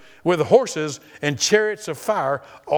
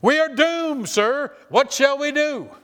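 high, panicked cry of doom; panicked exclaim; 10 semitones higher, slower than usual. Acted. Servant exclaiming to Elisha, high and panicked. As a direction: servant crying out in alarm, pitched high. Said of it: "He sent back to Elisha and exclaimed".

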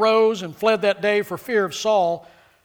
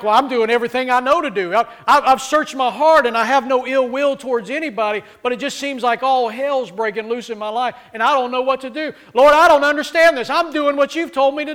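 pleading complaint; 5 semitones higher, 6 dB louder than usual. Pretend. Imagined prayer of a believer.

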